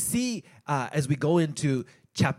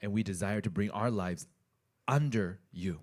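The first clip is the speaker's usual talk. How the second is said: prayer; 5 semitones higher, 6 dB quieter, faster than usual. Real, not acted. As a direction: pray quietly and humbly to God. Opening prayer before the message.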